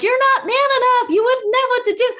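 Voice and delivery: high-pitched imitation of a sassy comeback; hammed-up retort from the waitress; mock taunt. Waitress taunts back in retold shouting match.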